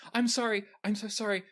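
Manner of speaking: high, frightened stammering apologies; cornered cultist apologizing in panic; panicked apology. Acted. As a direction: blurt sorry in a high panicky voice. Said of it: "with panic in her eyes".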